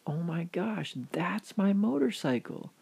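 acted astonishment, pitch lifting; amazed realization. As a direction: exclaim it in sudden recognition. Man recognises his motorcycle, amazed.

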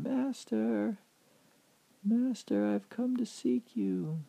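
high, drawn-out searching call. Acted. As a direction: call for someone you can't see. Calls out for unseen master.

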